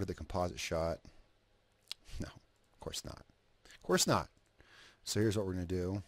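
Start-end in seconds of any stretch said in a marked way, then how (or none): 2.2-3.2 breathy whispered aside. Real, not acted. Quiet aside to himself. 3.7-4.3 high exclamation. Real, not acted. Repeats the aside louder.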